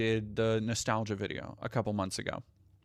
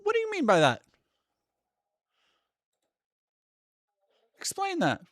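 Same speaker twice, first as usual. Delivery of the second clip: tiny cartoon-high voice demanding answers; squeaky demand; 4 dB louder than usual. Questioning chat, squeaky voice.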